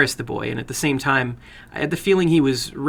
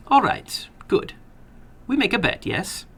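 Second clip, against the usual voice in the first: put-on sly older gentleman with an accent; probing the boy, a touch sly; foreign little man; 7 semitones higher than usual. Narrator voices the little man.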